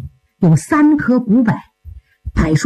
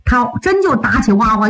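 ordinary delivery

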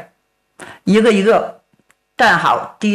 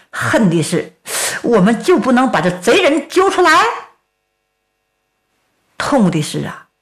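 ordinary delivery

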